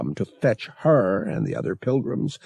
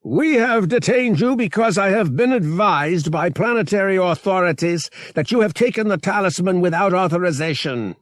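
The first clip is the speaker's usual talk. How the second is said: high, forceful official proclamation; inexorable; 9 semitones higher, 4 dB louder than usual. A narrator's reading. Captain announcing detention to Talisman's crew, inexorable. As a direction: press on relentlessly with formal orders. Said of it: "Captain Rios went on inexorably".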